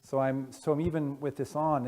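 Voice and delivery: ordinary talk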